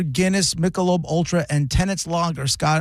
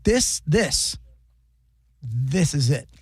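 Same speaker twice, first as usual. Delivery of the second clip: awed delight; slower than usual. Retold or imitated. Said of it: "I'm like".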